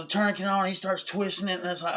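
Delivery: ordinary talk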